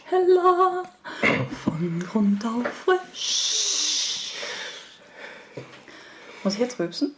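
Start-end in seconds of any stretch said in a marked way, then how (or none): 0.0-4.3 ad voice. Pretend. Jingle imitation.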